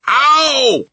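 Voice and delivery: very high, loud yelp; pained yell. A narrator's reading. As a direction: yell out in sudden pain. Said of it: "yelled the B F G".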